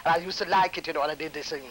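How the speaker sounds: ordinary delivery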